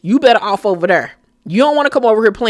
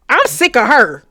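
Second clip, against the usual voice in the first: ordinary delivery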